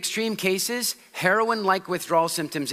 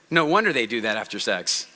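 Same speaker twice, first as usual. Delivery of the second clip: normal talking voice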